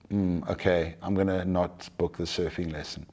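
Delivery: low, soft, defeated mumble; deflated giving up. Acted. Acted giving in to inner voice.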